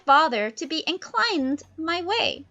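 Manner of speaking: Miranda's voice. Acted. Miranda questions her father, high and pleading. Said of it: "Miranda's like".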